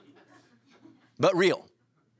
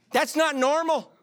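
high, slow disbelieving squeal; high drawn-out comic protest; mock incredulous; 12 semitones higher, 4 dB louder, slower than usual. Deadpan comic aside.

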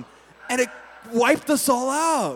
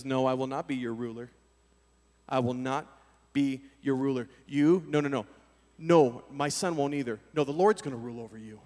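humble refusal; 9 semitones lower, 7 dB quieter than usual. Retold or imitated.

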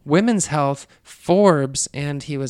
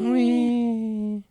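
excited whee; 9 semitones higher than usual. Real, not acted. Cheering for South by.

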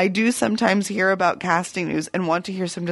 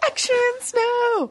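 drawn-out high; slower than usual. Pretend. Repeating the nickname, drawn out high.

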